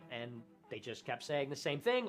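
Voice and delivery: ordinary talk, nothing marked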